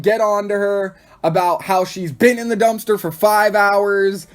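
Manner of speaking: ordinary delivery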